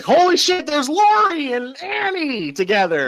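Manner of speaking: high, amazed gasp of recognition; surprised exclaim. Retold or imitated. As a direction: say it like you can't believe it. Imagined viewer reaction, very high.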